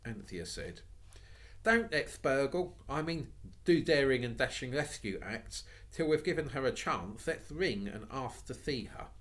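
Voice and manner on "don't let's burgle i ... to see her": put-on higher, earnest girl pleading with siblings; a young girl urging caution; Anthea's girlish voice. Girl urging her siblings to knock first, earnest.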